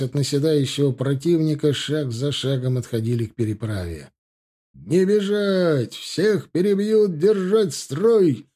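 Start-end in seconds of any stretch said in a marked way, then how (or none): 4.7-8.5 high, slow, drawn-out holler; barked battle order. A narrator's reading. Egorov stops his men from fleeing, barking orders. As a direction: stretch each order high over the battle. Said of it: "рявкнул Егоров".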